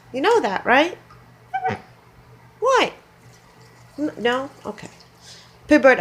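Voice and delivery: high coaxing pet voice. Real, not acted. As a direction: coax the bird gently, up high. Talking to the goose.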